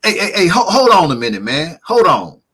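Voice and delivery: lightly voiced jittery guest; imitating flustered guest. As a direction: cut him off like a nervous driver. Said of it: "he's like".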